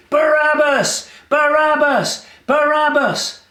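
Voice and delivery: high, drawn-out crowd chant; crowd calling. Acted. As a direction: call the name like a chanting crowd. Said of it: "they started calling".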